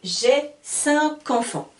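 slow cinq enfants linked with k, cin-kenfants; model, cin-kenfants; k liaison. Shown as a demonstration. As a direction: link cinq to enfants with a k, slowly. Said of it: "j'entends le son K".

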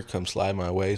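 ordinary delivery

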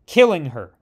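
plain reading voice

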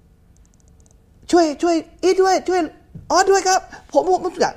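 high, slow pleading plea for help; mock son crying for help. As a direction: whimper like a captive son begging. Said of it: "ทำเสียง". (เสียงลูกชายขอความช่วยเหลือแบบล้อเลียน)

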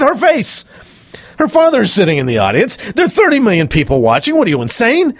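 high-pitched, incredulous retold telling-off; exasperated scolding. Coach scolds the leering host, exasperated and retold. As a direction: act out scolding someone who should know better.

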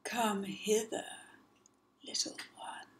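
soft, sly whisper; luring crocodile whisper. Acted. Crocodile whispering to lure the child. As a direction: coax him closer.

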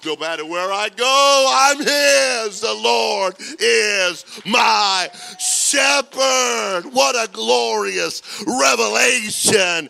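shouted, high, drawn-out preaching cry; full-force preaching. Real, not acted. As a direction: thunder it to the whole congregation, slowly.

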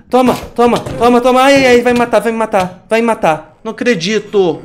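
high, loud frantic cries; raging gamer. Real, not acted. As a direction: yell in panic while losing. Dying to the Enderman.

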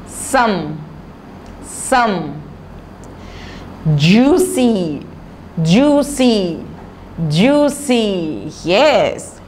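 put-on slow word-by-word reading for children, each word said thrice; slow reading drill. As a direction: read it out slowly so the children can repeat. Teacher drills a reading lesson. (धीमे-धीमे बच्चों को पढ़ाने वाली आवाज़)

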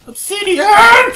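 high, drawn-out shriek of joy; ecstatic scream. Real, not acted. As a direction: scream it out in delight. Sees obsidian, screams.

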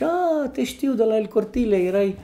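bright recognition from regulars, then easing down; excited customers. Retold or imitated. Diners who know him greet their old waiter.

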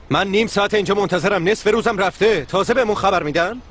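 angry on the phone. Acted. Boss yelling into the phone over a cancelled meeting, angry. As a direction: snap at the caller, fed up.

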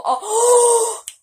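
loud high gasp; big theatrical intake of breath; exaggerated shocked gasp. Mock shock before repeating pun.

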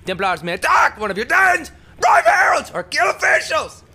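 loud, high-pitched screamed reading; practice screaming. Shown as a demonstration. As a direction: scream the text out like a screamo singer. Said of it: "I'm practicing screaming".

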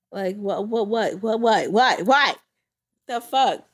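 normal talking voice

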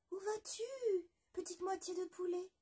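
high curious voice asking the way; a bright little creature calling out; questioning animal voice. Acted. Le loup interroge la moitié de poulet, voix aiguë. River asks the half-chick.

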